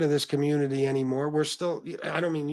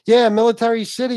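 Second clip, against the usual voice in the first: loud, higher mock shout of a catchphrase; quoting his catchphrase; 8 semitones higher, 9 dB louder than usual. Host imitating a late viewer's loud catchphrase. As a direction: belt it out like his old sign-off. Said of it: "he used to say".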